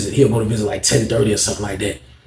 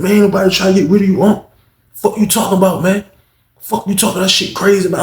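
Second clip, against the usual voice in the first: defensive protest; 8 semitones higher, 5 dB louder than usual. Retold or imitated. Taz defending himself loudly.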